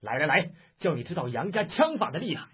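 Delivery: loud, high-pitched battle challenge; angry challenging shout. Acted. Yang with his spear to the Taoist, a shouted challenge. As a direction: call him out to fight, furious. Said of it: "叫道".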